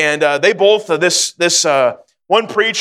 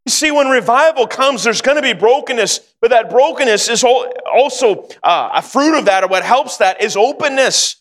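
raised, earnest preaching voice; 4 semitones higher than usual. Acted. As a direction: lift the pitch and preach it to the room. Preacher applies story to congregation.

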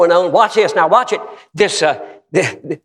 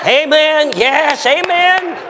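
high, rousing preacher's shout; calling out amens; 10 semitones higher, much slower than usual. Real, not acted. Preacher rousing his congregation, high hollered amens.

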